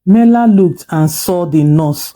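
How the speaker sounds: usual speaking voice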